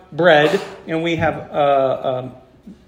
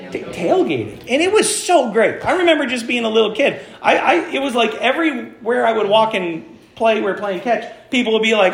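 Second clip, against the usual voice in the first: normal talking voice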